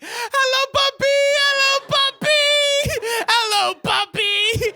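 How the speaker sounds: very high, loud, doting squeal; piercingly high impression of a gushing mother; cheek-pinching mum. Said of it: "trying to pinch my cheeks with the sound of her voice".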